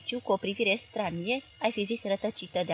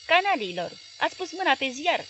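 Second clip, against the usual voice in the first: loud furious outburst; louder, angry cry of realisation; shouting; 6 semitones higher, 5 dB louder than usual. A narrator's reading. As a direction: yell the insult, stung. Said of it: "strigă patronul".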